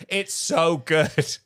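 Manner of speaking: ordinary talk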